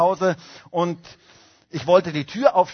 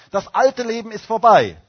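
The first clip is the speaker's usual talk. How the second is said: proclaimed refrain; 5 semitones higher, 7 dB louder than usual. Acted.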